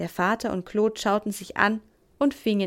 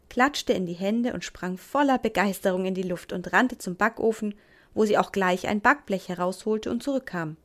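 usual speaking voice

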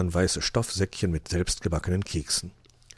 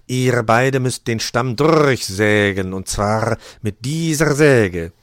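loud, drawn-out command; slow, booming announcement; announcing; 4 semitones higher, 8 dB louder, much slower than usual. A narrator's reading. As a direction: give a slow, weighty instruction to all. Loud instruction to the couple.

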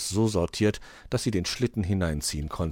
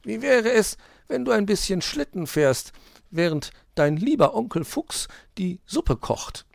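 very high, sing-song cajoling; smooth things over for the youngster; wheedling magpie; 13 semitones higher, 4 dB louder than usual. A narrator's reading. Magpie pretends fox was joking, falsely sweet.

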